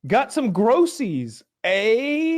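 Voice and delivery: slow, high mock-friendly salesman; mocking bug man. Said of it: "He said".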